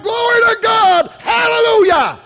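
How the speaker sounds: high, slow, loud shouts of praise; shouting praise. Retold or imitated. Preacher friend praising God aloud in bed, slow high shouts. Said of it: "he began to shout and praise God".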